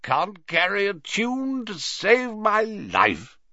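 high, drawling Slughorn voice; tipsy old professor. A narrator's reading. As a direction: bemoan it high and slow, tipsily.

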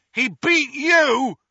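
high, loud furious yell; high loud shout of defiance; defiant yell. Acted. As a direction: hurl it back at the villain. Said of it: "yelled Ron".

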